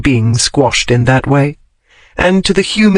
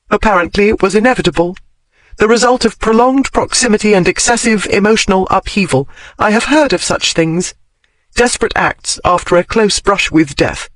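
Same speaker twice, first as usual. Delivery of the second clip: higher, fast, brisk lecturing tone; Daphne's voice; 6 semitones higher than usual. A narrator's reading. Daphne explains away her tears to him, fast and high. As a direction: rattle off a scholarly excuse.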